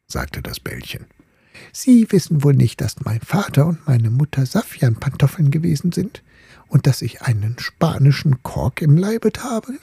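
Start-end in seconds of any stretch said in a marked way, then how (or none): 1.7-9.8 lightly voiced, higher, haughty little ball, last line whispered; a hint of a snooty young lady; proud little ball. The ball boasting of her parents to the top, lightly haughty.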